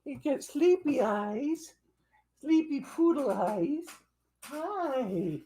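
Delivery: sing-song doting puppy talk. Real, not acted. Owner doting on her sleepy poodle, slow high coo. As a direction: coo it slowly and high to the pet. Cooing to the dog.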